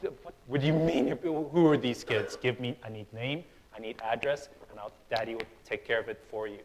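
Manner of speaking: loud, protective father demanding answers; a furious dad ready to confront the bullies; dad shouting over a bullied son; angry dad. Retold or imitated. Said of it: "if you're a dad".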